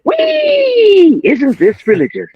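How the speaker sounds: long high-pitched exultant "Wee!", then excited quote; Travolta entrance. Retold or imitated. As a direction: mimic a flamboyant, whooping movie entrance. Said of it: "Travolta comes in and goes".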